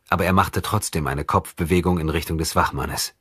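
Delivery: ordinary delivery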